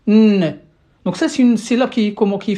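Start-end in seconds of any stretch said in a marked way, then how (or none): 0.1-0.6 a loud, held "n" with no vowel; nun sakin. Shown as a demonstration. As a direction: hum the consonant "n" alone. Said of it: "suivie de nun sakin".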